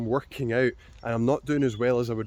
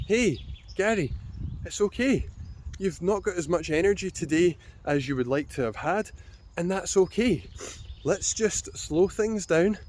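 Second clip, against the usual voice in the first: talking to a friend; 4 semitones higher than usual. Acted. Coaching a friend through a tough workout, kindly and reassuringly. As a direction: gently reassure a struggling friend. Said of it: "What would I say to a friend".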